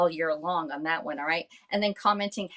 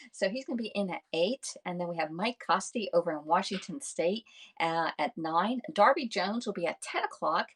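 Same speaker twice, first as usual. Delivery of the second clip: ordinary delivery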